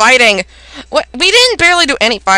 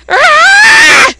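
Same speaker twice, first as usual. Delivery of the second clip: high, loud drawn-out scream; awful screaming; 7 dB louder than usual. Acted. Gamer voicing a battle cry, screaming.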